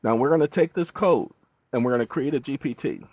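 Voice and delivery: usual speaking voice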